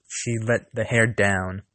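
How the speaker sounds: usual speaking voice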